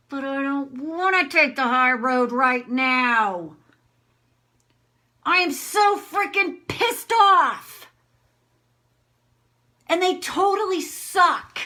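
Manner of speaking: loud, high outburst; demonstrated anger. Shown as a demonstration. Refusing to take the high road right now, angry. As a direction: burst out of the politeness and rant. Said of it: "I'm just gonna do anger".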